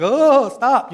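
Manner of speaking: drawn-out fed-up groan of words; exasperated. Acted. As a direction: act out being fed up. Listener fed up with overlapping voices.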